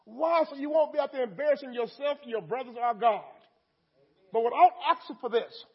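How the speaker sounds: loud, rising preacher's cry; fervent preaching. Acted. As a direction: call out to the Lord with full force. Opening prayer, preaching at full force.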